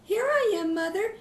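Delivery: put-on high, timid little kid's reply; small scared voice. Youngest kid answering mother from the clock case. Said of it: "in his soft, scared voice".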